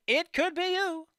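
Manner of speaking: high, slow, drawn out; hyped-up announcer. Acted. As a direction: stretch the YOU like a game show host. Repeated announcer hype line.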